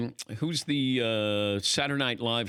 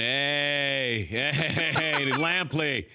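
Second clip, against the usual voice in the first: slightly raised, stretched-out call of recognition; drawn-out greeting; 3 semitones higher, much slower than usual. Retold or imitated. Comedian hailing him at the Olympics, drawn-out greeting. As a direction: imitate a long-held, hailing hey.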